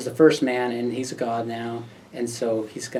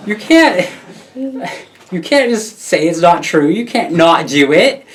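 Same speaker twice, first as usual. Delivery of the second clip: high loud insistence; 6 semitones higher, 11 dB louder than usual. Retold or imitated. Wife's retort continues.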